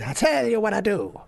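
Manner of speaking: hammed-up maestro; mock Italian accent. [name] offering the director a deal, Italian accent.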